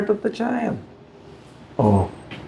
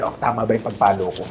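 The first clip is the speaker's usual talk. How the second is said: mock father checking his swing, quiet and quick; lightly voiced father asking himself, fast and soft; imitating testing father; 10 semitones lower, 9 dB quieter, faster than usual. Preacher voicing a father trying out his first swat, quiet mock question. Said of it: "Tinetesting pa ng tatay".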